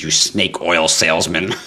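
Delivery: usual speaking voice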